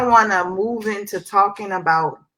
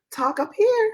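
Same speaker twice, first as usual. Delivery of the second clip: talking up high. Shown as a demonstration. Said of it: "That's a singer's trick".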